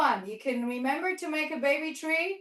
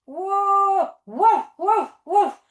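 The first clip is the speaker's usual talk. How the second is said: loud bouncy woof; playful dog bark; 4 semitones higher, 7 dB louder, much slower than usual. Pretend. Teacher barking with the kids as a dog pack, loud and playful. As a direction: bark like a happy puppy.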